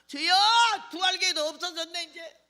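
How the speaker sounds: high, exclaimed complaint; retold lament to God. Preacher telling God nobody has come by eleven, high dismayed cry. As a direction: cry out in mock dismay to the Lord.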